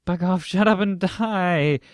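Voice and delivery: loud, high, drawn-out outburst; angry retort. A narrator's reading. Speaker snapping back at being teased about a guy, angry outburst. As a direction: lash out slowly and loudly, word by word.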